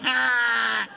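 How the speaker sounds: high, drawn-out "Ah!"; a sustained mock shriek; mimicked shriek. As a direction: squeal it out high, imitating her. Mimicking someone's high squeal.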